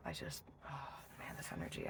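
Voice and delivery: breathless whisper. Real, not acted. Tarot reader whispering, overwhelmed by the energy.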